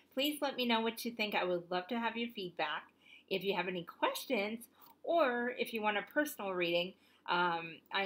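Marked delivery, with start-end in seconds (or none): none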